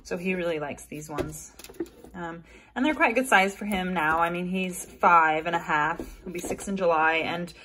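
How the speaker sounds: usual speaking voice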